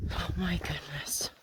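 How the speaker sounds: breathy, startled whisper. Real, not acted. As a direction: hiss it under your breath. Startled by a bee.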